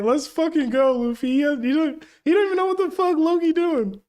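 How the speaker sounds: high, loud excited rant; laughing disbelief, loud and high; hyped yell. Real, not acted. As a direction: gush it out, can't contain yourself. Hyped reaction to Luffy's choice.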